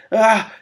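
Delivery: high, loud startled shriek; startled cry. Acted. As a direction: shriek in sudden alarm. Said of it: "said Vamis".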